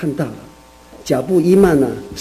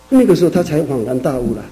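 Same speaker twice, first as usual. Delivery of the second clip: ordinary delivery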